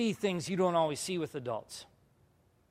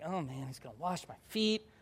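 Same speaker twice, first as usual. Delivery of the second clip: much softer, as if thinking aloud; hushed mock dread; 9 dB quieter than usual. Speaker replays nervous thought before foot washing, hushed mock dread. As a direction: keep the worry under your breath. Acted inner dread, sitting down.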